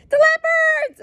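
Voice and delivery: high squealing fake alarm; joking made-up warning; mock alarmed mother. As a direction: shriek it like a fussing mother. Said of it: "Oh, just kidding".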